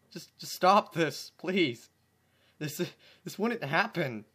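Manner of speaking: loud, slow pleading cry; desperate plea. Real, not acted. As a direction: beg it to stop. Begging the fic to stop.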